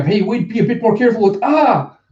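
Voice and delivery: high, quick complaint breaking into a yelp; alarmed protest. Acted. Passenger objecting to careless handling, alarmed and high.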